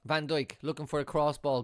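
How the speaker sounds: normal talking voice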